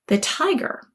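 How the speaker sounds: usual speaking voice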